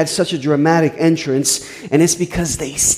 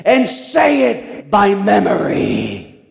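put-on drunk's demand; 4 semitones higher, much slower than usual.

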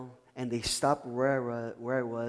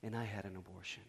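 hushed, low retelling of a painful admission; tearful confession, retold; 7 semitones lower, 14 dB quieter than usual. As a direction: let it drop very softly, without sobbing. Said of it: "the model right beside her starts crying".

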